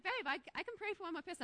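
husband bargaining to keep praying, quick and pleading. Retold or imitated. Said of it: "And he's like".

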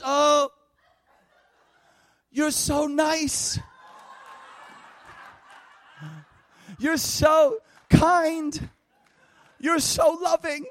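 high, loud, drawn-out gushing; overwhelmed gushing. Retold or imitated. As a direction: imitate a woman swooning with adoration. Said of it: "she's a little bit beside herself".

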